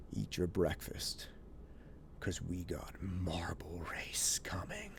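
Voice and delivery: put-on hushed conspiratorial whisper to the kids. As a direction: whisper it like a secret plan. Secretly telling the kids.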